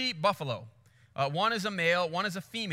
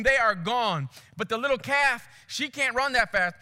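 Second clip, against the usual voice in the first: usual speaking voice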